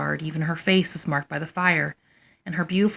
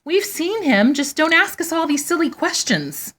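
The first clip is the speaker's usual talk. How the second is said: 1 whiny high protest; 10 semitones higher, 3 dB louder than usual. A narrator's reading. Sisters protest indignantly.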